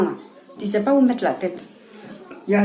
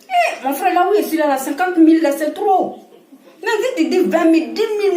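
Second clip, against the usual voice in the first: laughing disbelief; 7 semitones higher than usual. Real, not acted. Brother asks for fifty thousand.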